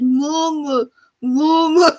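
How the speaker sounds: lightly imitating a stuck toddler's little cry; baby calling mama. Mother retelling her baby stuck in crib bars, mild baby voice. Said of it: "She's like".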